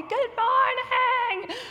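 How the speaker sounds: very high, slow, stretched-out yell; kids' yell back. Retold or imitated. Little kids yelling back at their leader, high drawn-out mock yell. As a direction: imitate little kids hollering a greeting. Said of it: "they yell back at me".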